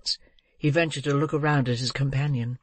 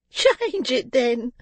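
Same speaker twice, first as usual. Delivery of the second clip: very high, gleeful jeer; laughing taunt; 10 semitones higher, 4 dB louder, much slower than usual. A narrator's reading. Girl goading the old man, laughing taunt. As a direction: toss it out high with a mocking laugh. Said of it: "responded the girl with a laugh".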